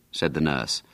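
ordinary delivery

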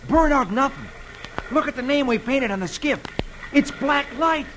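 high-pitched amazed outburst; excited exclamation. A narrator's reading. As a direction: exclaim it like a thrilled discovery. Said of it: "And he exclaims".

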